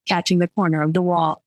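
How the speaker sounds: usual speaking voice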